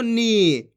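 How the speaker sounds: नी with a long held ee; drawn-out vowel. Shown as a demonstration. Teacher models ना with बड़ी ई, नी drawn out long. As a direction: stretch the ई in नी. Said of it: "जिस तरीके से मैं खींच खींच के पढ़ रहा हूँ".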